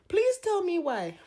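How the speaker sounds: high-pitched pleading wail; mock-despairing, high plea; exasperated plea. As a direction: beg for an explanation in a high voice.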